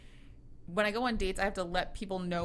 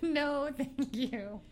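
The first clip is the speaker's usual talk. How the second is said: mock refusal; 6 semitones higher, much slower than usual.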